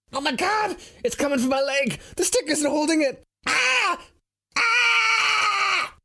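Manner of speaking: very high-pitched panicked shrieks; screaming as the snake comes for his leg; shrill cowardly panic; cowardly yell. Acted. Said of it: "we both cowardly yell together".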